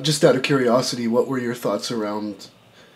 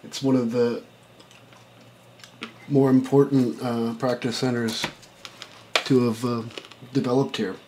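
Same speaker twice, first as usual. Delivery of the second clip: talking while crunching a mint; words through a crunching mint; crunching an Altoid; 5 dB quieter than usual. Real, not acted. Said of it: "Sorry, I'm crunching an Altoid".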